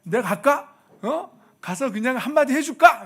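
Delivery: ready to storm off and defend her; eager loud offer. Retold or imitated. Husband eagerly offering to go tell off the coworker, loud.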